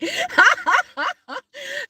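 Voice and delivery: high-pitched peal of laughter; real helpless laugh. Real, not acted. Can't stop finding it funny, laughing. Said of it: "I'm sorry for laughing".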